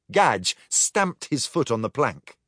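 plain reading voice

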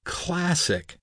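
high-pitched, amused jab without laughing; chuckled quip. A narrator's reading. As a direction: toss it out high, as light teasing. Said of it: "Pipe chuckled".

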